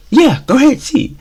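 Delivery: daring you to do it, up high; sarcastic parent. Retold or imitated. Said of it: "type tone in their voice".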